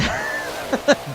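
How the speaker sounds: real burst of laughter. Real, not acted. Breaks out laughing at chat joke.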